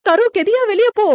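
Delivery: loud, high cry of warning; urgent shout. A narrator's reading. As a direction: order the boy out of danger, loudly. Wall falling, orders boy out.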